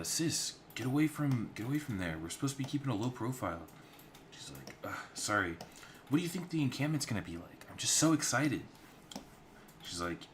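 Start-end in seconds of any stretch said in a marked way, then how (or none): 0.0-3.6 put-on hushed urgent older sister, higher pitch; a big sister hissing at her sibling. Older sister scolds the younger. 4.8-8.6 high, quick and eager; bubbling over about the encampment; girlish excitement rising in pitch; excited younger sister. Acted.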